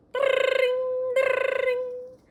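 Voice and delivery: high, loud ringing alarm clock; Pig's alarm clock going off, high and loud. A narrator's reading. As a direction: ring it out like a bedside bell. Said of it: "went Pig's alarm clock".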